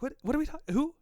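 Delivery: high, befuddled imitation; hammed-up bewildered questions; mock confusion. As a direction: mimic someone completely lost. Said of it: "you're just like".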